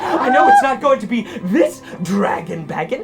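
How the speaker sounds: high, manic voice full of laughter; crazed prize reader. Acted. [name] reading out prizes, laughing maniacally. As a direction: read it out as if losing your mind, cackling. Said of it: "as I go crazy over here".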